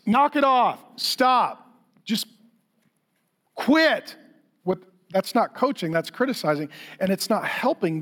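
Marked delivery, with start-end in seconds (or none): 0.1-4.2 sharp, higher-pitched impatient commands; mock exasperated parent barking orders; irritated critic. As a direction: snap at the kid like a fed-up critic. Critic parent snapping at child.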